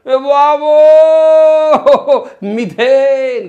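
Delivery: very loud, high, long-held shout; drawn-out attention-grabbing holler; teacher's yell. Shown as a demonstration. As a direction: bellow it to jolt the class awake. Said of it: "हे असं का ओरडते मास्तर?".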